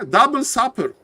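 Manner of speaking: "double supper" pitched high; high exclamation. Real, not acted. Caster confirming his guess, high-pitched exclamation. Same phrase said twice, contrasted.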